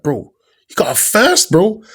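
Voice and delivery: loud retold amazement; imitated excited mate. Retells mate's excited news.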